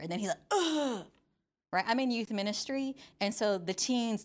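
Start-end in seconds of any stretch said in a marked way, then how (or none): 0.5-1.1 high, dragged-out mock groan; exasperated teen groan. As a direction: groan like an anguished teenager. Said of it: "that's their thing".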